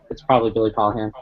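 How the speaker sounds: ordinary talk, nothing marked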